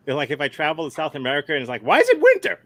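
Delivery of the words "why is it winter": high squeaky voice. Pretend. Imagined South America traveller, squeaky.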